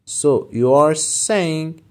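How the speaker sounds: very slow, clear teacher read; slow model phrase. Shown as a demonstration. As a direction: say it slowly for learners. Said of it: "teacher models phrase".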